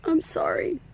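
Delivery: holding back real tears while pleading for help; emotional, near tears. Real, not acted. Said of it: "I don't mean to get emotional".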